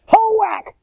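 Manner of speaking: very high, drawn-out whack cry; mock karate-style chop cry. Man swings shovel down on snake, whooping. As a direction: whoop it as the blade comes down. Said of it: "and I went".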